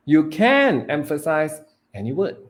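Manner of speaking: CAN pitched high, loud and stretched; stress on CAN. Shown as a demonstration. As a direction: punch the word can. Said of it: "emphasizing different words".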